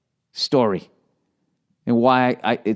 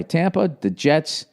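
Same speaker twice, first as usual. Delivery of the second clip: ordinary talk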